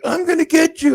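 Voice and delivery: high, loud mocking threat; drunk threat. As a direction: spit out a drunk's empty threat. Drunk threatens while removed.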